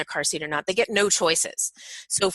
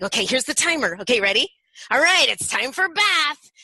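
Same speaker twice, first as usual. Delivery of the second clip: playful announcement; 6 semitones higher, 5 dB louder than usual. Shown as a demonstration. Parent starting game excitedly.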